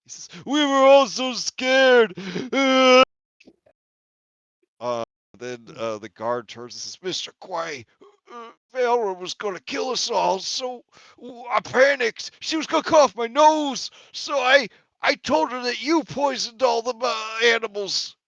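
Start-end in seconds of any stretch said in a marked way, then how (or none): 0.5-3.0 loud, high hammed-up wail; slow, drawn-out mock sobbing; breaking down crying. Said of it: "he just starts breaking down". 7.0-18.1 high-pitched frantic confession; panicked guard. Acted. Frightened guard confesses to Quay.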